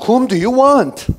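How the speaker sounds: loud, high shouted question; addressing a crowd. Acted. Pilate putting the question to the crowd, loud shout. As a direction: call it out over a huge crowd. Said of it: "Pilate said to the crowd".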